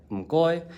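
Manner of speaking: "mkoy" said high in Cantonese tone; Cantonese m goi. Shown as a demonstration. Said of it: "The fundamental gesture behind".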